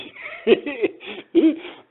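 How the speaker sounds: loud high-pitched laugh; éclat de rire. Real, not acted. Rit de son imitation du coq. (grand rire aigu)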